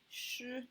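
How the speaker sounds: 尸 high and level; sustained, even high tone on 尸; first tone. Shown as a demonstration. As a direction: hold 尸 on one high pitch. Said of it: "their first tone".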